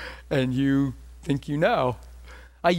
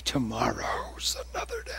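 soft unvoiced whisper, eager; whispered self-talk; 3 semitones lower, 5 dB quieter than usual. Preacher whispering his checklist thought in the bathroom. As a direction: breathe it out like a private promise.

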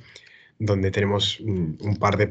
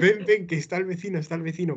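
excited urging; 6 semitones higher, faster than usual. Retold or imitated. Calling flatmate Paula to the window, excited and giggling. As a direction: hurry her over, giggling.

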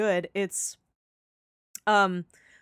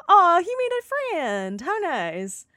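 cooing; 8 semitones higher, slower than usual. Retold or imitated. Speaker voices own reaction.

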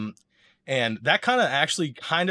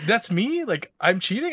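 incredulous; 10 semitones higher, 4 dB louder than usual. Retold or imitated. His own stunned reply retold.

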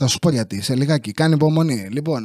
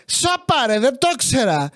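mock surprise; 6 semitones higher than usual. Exclaiming, much higher.

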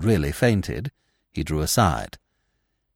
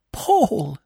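a high, anguished cry of his name; 13 semitones higher than usual. A narrator's reading. Widow sees her husband's body and cries out, anguished. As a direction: cry out to the dead husband in despair. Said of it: "she cried".

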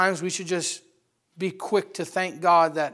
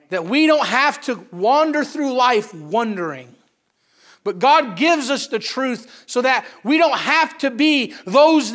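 slow, higher, louder preacher cry; preaching at full force; 5 semitones higher, 7 dB louder, slower than usual. Acted. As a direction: drive it home to the congregation. Preaching climax, slow and loud.

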